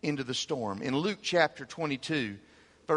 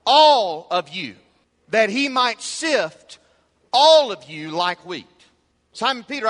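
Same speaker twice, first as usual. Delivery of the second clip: loud, higher preaching with punched phrases; forceful preaching; 7 semitones higher, 9 dB louder than usual. Acted. Preacher voicing Jesus's warning to Simon Peter, forceful preaching. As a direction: drive each phrase home to the congregation.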